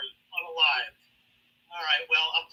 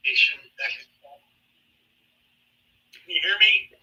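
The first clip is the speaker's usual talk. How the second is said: choppy breaking-up phone line; glitchy call audio; bad connection; 2 semitones higher than usual. Real, not acted. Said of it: "you're breaking up really bad".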